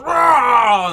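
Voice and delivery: loud, high monster roar; mascot roar. Acted. Mascot goes up to the kids, loud pretend roar. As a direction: roar to playfully scare children. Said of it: "goes up to the kids and goes".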